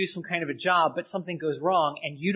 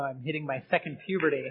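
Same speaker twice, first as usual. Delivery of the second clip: ordinary talk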